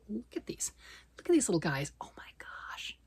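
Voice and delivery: hushed whisper over tiny yarn. Real, not acted. Showing viewers the little minis, whispering in awe. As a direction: breathe it out in awe.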